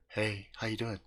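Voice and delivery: low quiet pickup line; smooth low greeting. Retold or imitated. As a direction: play it cool and suave. Terry flirts.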